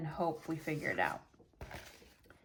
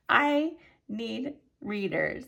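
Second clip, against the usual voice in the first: high laugh, then slow, drawn-out words; laugh, then space out each word; 5 semitones higher, slower than usual. Real, not acted. Admitting to viewers she needs reading glasses, laughing. Laughing admission of needing readers.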